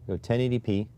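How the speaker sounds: ordinary talk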